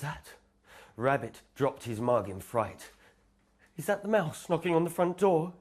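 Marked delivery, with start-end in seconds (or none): none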